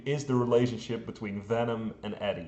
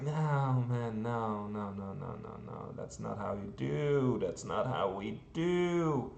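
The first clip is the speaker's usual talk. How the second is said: fading mock dismay; 2 semitones lower, 6 dB quieter than usual. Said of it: "that I'm like".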